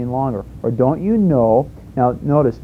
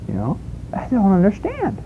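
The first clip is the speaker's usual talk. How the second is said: puzzled new believer; 7 semitones higher, 4 dB quieter than usual. Retold or imitated. New convert's confused voice.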